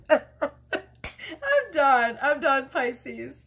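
high, loud laughing outburst; cracking up, words pitched high; real helpless laughter. Real, not acted. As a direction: give up through a burst of laughter. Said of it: "I cannot stop laughing".

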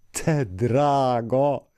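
very slow, drawn-out cry of delight; tender exclamation. Acted. Woman hugging Duci, crying out slow and drawn out. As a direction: gush over the little boy. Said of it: "kiáltotta".

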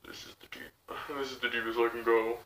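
low, very soft attempt at a deep voice; deepest voice. Shown as a demonstration. Reactor showing how deep they can go, low and soft. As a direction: drop to the very bottom of your range, quietly. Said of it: "I can't do a deep of a voice like that".

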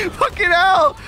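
high, strained shout; shouted curse. Real, not acted. Player reeling after a scream, high cursing shout.

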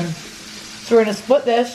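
talking through a mouthful of bread; words round a bite of bread; mouth full. Real, not acted. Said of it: "Sorry, I have bread in my mouth".

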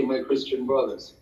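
usual speaking voice

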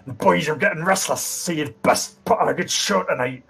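rough-and-ready bandit boss, put-on voice; Brill Havercross. Bandit introduces himself to the entertainers, brusque character voice. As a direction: greet the hired act as a brusque bandit. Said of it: "as he turns and looks at you".

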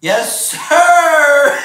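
loud high triumphant shout; excited shout. Real, not acted. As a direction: shout it out in victory. Cheering shout.

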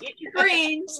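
sing-song motherly order; imitating a mom calling eat your greens; mock Southern mom.

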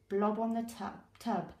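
usual speaking voice

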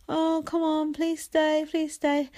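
high, drawn-out imitation of begging; imitating whiny begging to stay; mock pleading. Imitating housemates begging him to stay.

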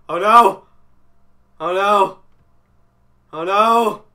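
loud rising cries of dread; each one a separate loud burst; alarmed repeating. Real, not acted. Player dreading what is behind him, loud alarm.

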